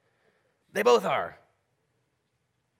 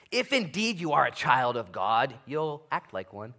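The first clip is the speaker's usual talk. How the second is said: slow, pressed, louder challenge; emphatic challenge; 2 semitones higher, 6 dB louder than usual. Retold or imitated. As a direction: lean hard on each word. Prophet's call to repent.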